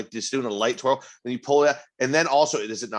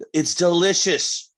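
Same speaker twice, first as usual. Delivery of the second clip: gushing praise; 6 semitones higher, 3 dB louder than usual. Acted. Gushing about fruity beer.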